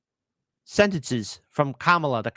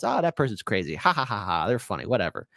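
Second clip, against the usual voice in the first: dismissive shrug; 8 semitones lower, 3 dB quieter than usual. Retold or imitated. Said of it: "Crazy ha-ha is".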